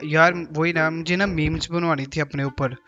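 ordinary delivery

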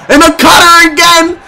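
hyped play-by-play call; wrestling commentator. Acted. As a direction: call the match with hype. Excited play-by-play calling.

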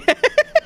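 real high-pitched laughing fit; high helpless laugh. Real, not acted. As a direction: crack up in a high squeal of laughter. Laughing after cursing, says it twists him. (wysoki, niepohamowany śmiech)

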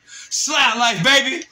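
high, loud sign-off yell; catchphrase shout. Real, not acted.

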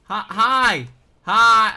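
loud, high greetings; calling out. Real, not acted. Gamer trying to talk to another player, calling out. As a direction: hail them at full voice.

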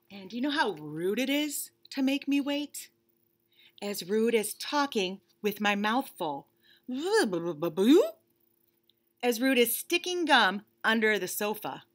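lightly voiced bunny, impatient and fed up; a put-upon bunny stuck waiting; bored bunny. Bunny complaining to the reader about waiting, mildly whiny.

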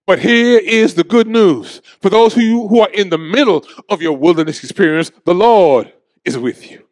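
usual speaking voice

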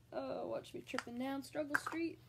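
ordinary talk, nothing marked

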